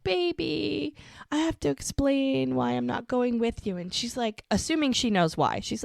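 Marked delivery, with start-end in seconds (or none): 0.0-3.8 high-pitched mock pleading. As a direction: coax a partner, imitating him. Retold man pleading with partner.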